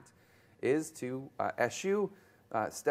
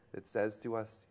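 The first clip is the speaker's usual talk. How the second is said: ordinary talk, nothing marked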